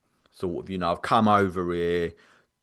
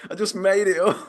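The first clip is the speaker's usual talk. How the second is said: high-pitched frantic protest; squeaky-high mock pleading; retold pleading; 12 semitones higher than usual. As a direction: act out a panicked confession. Retold pleading with outraged friend.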